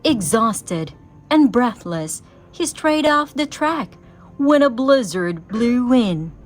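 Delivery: ordinary delivery, nothing marked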